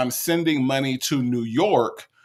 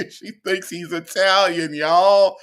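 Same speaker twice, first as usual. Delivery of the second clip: higher-pitched, through laughter; laughing disbelief; 5 semitones higher, 4 dB louder than usual. Real, not acted. Reactor tells viewers of her mistaken belief, laughing disbelief. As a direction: tell the audience while cracking up.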